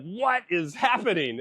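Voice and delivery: slow, high-pitched mock alarm; imitated alarmed wife. Wife calling from the bathroom as child screams, mock alarm. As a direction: draw it out high, like a worried mother calling. Wife's alarmed call, acted out.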